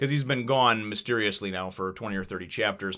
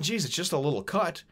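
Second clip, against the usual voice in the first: higher-pitched, lightly dismissive; Luffy shrugging it off; 7 semitones higher than usual. Retold or imitated. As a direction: play down the injury, no big deal. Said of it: "he goes and he's like".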